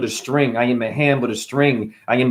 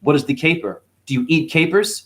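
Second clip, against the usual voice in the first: normal talking voice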